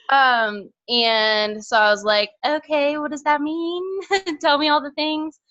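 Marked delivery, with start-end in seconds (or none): 2.4-5.4 eager curiosity. Retold or imitated. Eager question to mentor.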